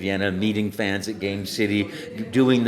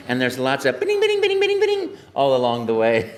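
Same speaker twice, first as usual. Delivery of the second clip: mock game bleeps, pitched way up; bouncy sound effects; 4 semitones higher, 6 dB louder than usual. Voice actor mimicking level sounds, high and bouncy. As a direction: sing out the coin sounds playfully.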